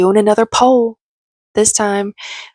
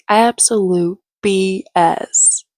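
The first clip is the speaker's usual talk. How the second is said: slow sarcasm; much slower than usual. Real, not acted.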